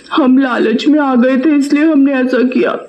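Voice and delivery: pleading swindler wife. Acted. Caught swindler's wife begging the moneylender for pardon. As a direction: plead for mercy, admitting greed.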